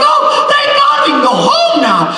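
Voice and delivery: high, loud, slow relieved shout; loud high-pitched mock relief. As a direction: yell your relief to the sky. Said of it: "I'm like".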